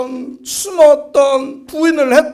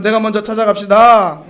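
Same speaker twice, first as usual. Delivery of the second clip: call and response; 5 semitones lower, 3 dB louder than usual. Shown as a demonstration. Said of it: "따라하겠습니다".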